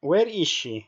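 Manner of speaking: ordinary talk, nothing marked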